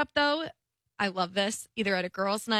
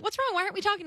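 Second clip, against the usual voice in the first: worried friend; 9 semitones higher, much faster than usual. Retold or imitated.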